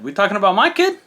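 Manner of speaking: high, rising retold disbelief; incredulous. Dad replying to the doctor about his baby, incredulous. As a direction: push the pitch up as if it's absurd.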